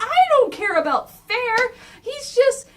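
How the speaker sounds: high, loud, fed-up snap; angry outburst. Acted. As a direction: cut him off, furious. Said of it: "he's going like".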